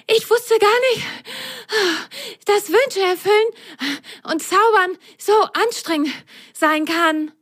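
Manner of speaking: high, panting sock voice; out of breath. A narrator's reading. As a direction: gasp it out while running. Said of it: "Lili keuchte".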